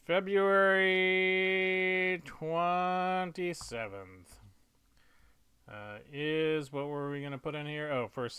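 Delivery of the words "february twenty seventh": loud, very slow, stretched syllables; drawn out. Real, not acted. Writer filling in a date, slowly drawn out. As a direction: hold each syllable long and high. Drawing out date while writing.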